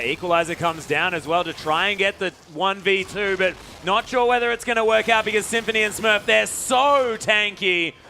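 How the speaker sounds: high, loud esports commentary building through the fight; live play-by-play. Real, not acted. Caster calling the Equalizer coming down on a tanky team, excited play-by-play. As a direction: call the fight loud and high, stretching the key words.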